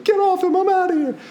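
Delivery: high frantic protective yelp; high-pitched mock panic, grabbing the baby. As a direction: squeal it like a panicked mom. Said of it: "and I'm like".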